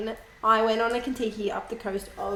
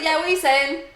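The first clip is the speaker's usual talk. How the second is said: English slang voice; 6 semitones higher, 5 dB louder than usual. Retold or imitated. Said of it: "He's like".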